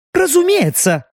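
high, spat-out word. A narrator's reading. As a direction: spit the word out. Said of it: "словно сплюнула та".

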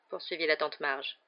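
ordinary delivery

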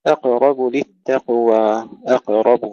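emphatic recited أقرب للتقوى, قاف released; bounced qaf. Shown as a demonstration. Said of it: "قلقلة القاف في الكلمتين".

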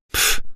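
short dismissive puff of air; brief huffy snort; scornful snort. A narrator's reading. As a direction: snort "pf" in contempt. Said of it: "фыркнула девушка".

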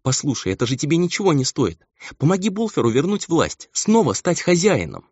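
high-pitched, imploring voice; urgent, near-tears entreaty; tearful pleading. A narrator's reading. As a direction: beg as if close to tears. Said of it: "чуть не плача".

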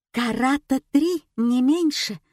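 usual speaking voice

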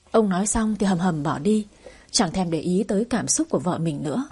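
ordinary delivery, nothing marked

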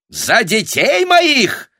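high, loud, drawn-out squeal; shrieking peasant woman. A narrator's reading. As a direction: shriek back at the mistress. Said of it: "та же самая баба". (протяжный бабий визг)

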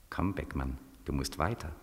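low, hushed voice from inside; low inner voice. Acted. Inner voice urging Beckmann to wake, low and hushed. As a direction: murmur it low like a voice in his head.

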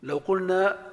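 ordinary talk

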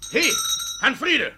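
high, loud call across the room. Acted. Judge loudly calls out for the bailiff. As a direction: shout for the bailiff.

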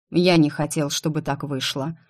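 ordinary delivery, nothing marked

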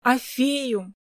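mildly indignant correction; understated childish protest. A narrator's reading. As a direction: insist like an offended little girl. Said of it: "возмутилась Кристина".

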